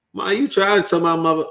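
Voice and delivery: high, loud call to an imagined mother; mock scolding call. Son shouting at his mother to go home, loud. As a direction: call her out like a fed-up daughter.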